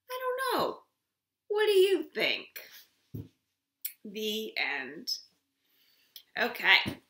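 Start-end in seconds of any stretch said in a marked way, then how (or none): 0.1-5.1 lively storybook voice, high and loud; reading to a toddler. Acted. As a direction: read it big for little ones. Reads picture book aloud.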